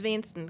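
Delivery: wenigsten shortened to "weensten"; Platt pronunciation. Shown as a demonstration. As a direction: say wenigsten with its middle syllable swallowed. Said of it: "it's the Platt, version of that".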